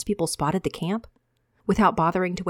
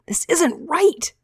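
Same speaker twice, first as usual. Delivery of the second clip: much higher, slow, louder protest; anguished outcry; 11 semitones higher, 4 dB louder, much slower than usual. Acted. Javen lunging forward, high, slow outcry. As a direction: cry out against the injustice.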